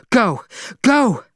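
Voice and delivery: loud high repeated Go; urgent shout. Acted. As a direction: yell at the driver to move. Urging the driver.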